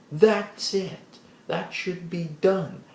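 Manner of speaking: ordinary delivery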